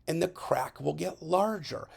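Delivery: ordinary talk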